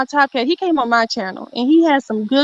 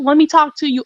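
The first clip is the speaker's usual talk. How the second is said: ordinary delivery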